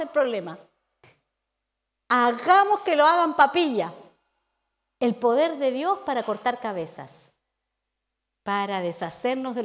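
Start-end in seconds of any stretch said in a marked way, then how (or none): none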